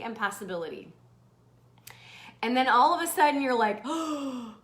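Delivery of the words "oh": breathy awed whisper; hushed excitement. Acted. Host acting out sudden inspiration, hushed and awed. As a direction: let it out softly after a gasp. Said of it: "you get really freaking excited".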